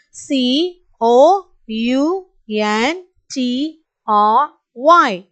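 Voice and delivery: each letter slow and spaced; slow spelling. Shown as a demonstration. Teacher spelling slowly for the class. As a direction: spell slowly so kids can follow. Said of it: "Repeat along with me, children".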